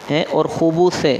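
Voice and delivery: ordinary talk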